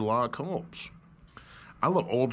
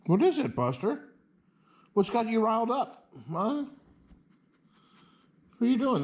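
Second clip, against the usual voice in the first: pitched up, playful questions to the dog; high dog-talk voice; 9 semitones higher than usual. Real, not acted. Asking the riled-up dog what's wrong, high playful dog talk.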